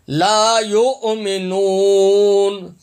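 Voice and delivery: measured Quranic chant; slow Quran recitation. Shown as a demonstration. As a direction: draw the verse out like a reciter. Recites verse with tajweed.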